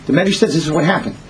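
usual speaking voice

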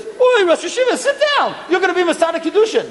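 high, eager honoring voice; warmly insistent elderly rabbi; deferential rabbi. Retold or imitated. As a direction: urge the great sage to sit. Rav Ruderman honors Rav Moshe.

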